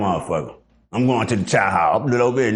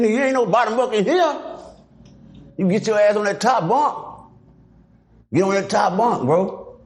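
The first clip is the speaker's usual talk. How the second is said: high, insistent orders; telling the scared cellmate to take the top bunk; bossy refusal; 8 semitones higher than usual. Retold or imitated. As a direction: lay down the law on the bunk.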